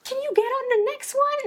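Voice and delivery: high, rushed panicked request; a mother shouting a hurried plan to her son through the doors; frantic plea. Retold or imitated. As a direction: blurt it out high and fast, in a panic. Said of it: "I don't know why I said".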